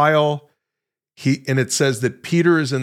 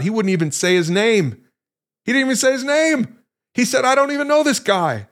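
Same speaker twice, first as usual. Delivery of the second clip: building to high-pitched, quicker disbelief; incredulous; 9 semitones higher than usual. Real, not acted. Preacher to listeners on Peter not naming Jesus, incredulous. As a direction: climb higher with each line, astonished at the denial.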